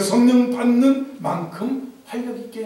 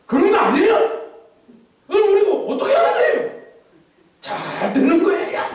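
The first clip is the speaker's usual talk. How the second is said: put-on loud, high, clipped Gyeongsang accent; 9 semitones higher, 4 dB louder than usual. Preacher mimics the Gyeongsang manner for the congregation, a brisk loud Gyeongsang accent. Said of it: "경상도는 말투가 벌써 탁탁 올라가고".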